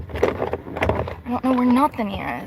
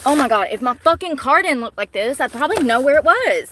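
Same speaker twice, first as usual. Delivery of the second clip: exasperated outburst; 3 semitones higher, 7 dB louder than usual. Real, not acted. Frantic on live, cursing the messy car, exasperated.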